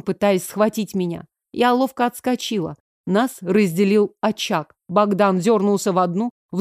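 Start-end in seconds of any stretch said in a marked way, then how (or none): none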